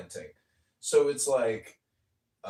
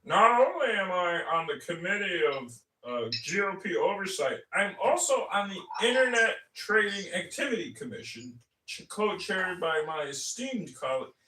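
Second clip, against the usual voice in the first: pompous politician; 7 semitones higher than usual. Retold or imitated. Mocking LaHood's boastful clip, pompous and high. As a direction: puff yourself up like a self-important official. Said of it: "There's a clip of him saying".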